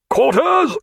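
high, loud single command; shouted order. A narrator's reading. Captain ordering crews to quarters, shouted. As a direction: snap the crew to their guns.

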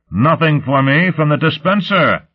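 high, boastful crow. A narrator's reading. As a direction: crow over the good news. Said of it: "Lot crowed".

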